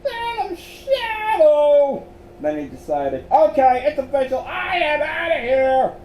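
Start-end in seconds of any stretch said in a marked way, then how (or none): 0.0-2.0 very high, loud, drawn-out squeak; small frightened voice. A narrator's reading. Miles repeats the ghostly name, small frightened squeal. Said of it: "repeated in a small voice". 3.3-5.9 high, loud outburst, slowing at the end; panicky resolve. A narrator's reading. Scared Miles quits the case, high panicky outburst.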